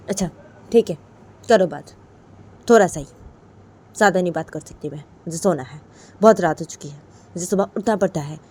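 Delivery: put-on loud, impatient voice of the girlfriend; annoyed girlfriend. Imitating the girlfriend refusing to talk, loud and impatient. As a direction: brush him off loudly as the tired girlfriend. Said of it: "फिर तो बोलती है".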